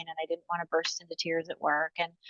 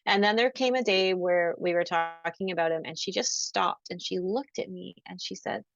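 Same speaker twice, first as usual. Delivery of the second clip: ordinary talk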